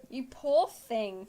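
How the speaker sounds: slow, drawn-out mock sympathy; mock pity. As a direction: pity them slowly. Mock pity at editing chore.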